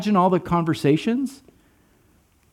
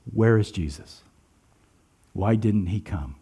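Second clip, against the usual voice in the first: low, soft voice of grieving sisters; subdued wondering; 9 semitones lower, 6 dB quieter than usual. Acted. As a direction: ask it low and heavy-hearted.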